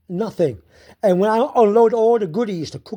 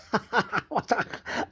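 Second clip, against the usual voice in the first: soft, breathy run of giggles; helpless little chuckles; laughing; 10 dB quieter than usual. Real, not acted. Laughing after the car started, amused. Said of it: "I was laughing".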